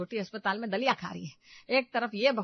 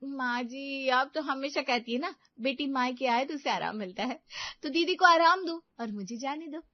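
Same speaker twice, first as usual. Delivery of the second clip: sly daughter-in-law; 6 semitones higher, 3 dB louder than usual. Acted. Meenu voice.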